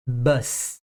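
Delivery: Bus repeated with a voiceless hissing S; unvoiced final s. Shown as a demonstration. Teacher repeating bus for students, voiceless S. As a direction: end Bus on a clean breathy S. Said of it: "Quando você fala ônibus no singular".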